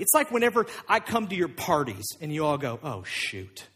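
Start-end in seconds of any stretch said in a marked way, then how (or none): 2.8-3.7 low, hushed "caught out" reaction; muttered dismay. Acted. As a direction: mutter it as an unwelcome guest walks in. Party guests notice pastor arriving.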